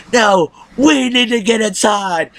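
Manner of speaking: slow, high put-on voice mocking her; mocking Deanna. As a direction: drag it out like a wannabe badass. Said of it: "Deanna was like".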